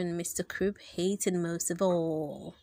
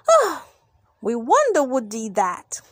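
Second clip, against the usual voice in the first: shout; 7 dB louder than usual. Acted. Crowd shouts together about the prank, loud shout. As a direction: shout the question out. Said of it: "shouted the".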